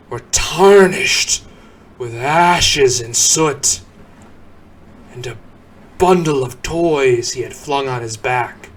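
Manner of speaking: plain reading voice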